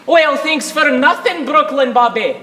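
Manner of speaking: ordinary talk, nothing marked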